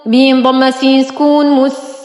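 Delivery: ordinary talk, nothing marked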